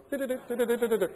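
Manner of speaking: quick, mocked clicking of a rotary dial; pulse dial clicks. Showing off to the teacher, fast imitated dial clicks.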